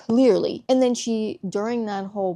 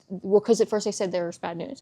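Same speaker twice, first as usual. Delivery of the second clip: ordinary delivery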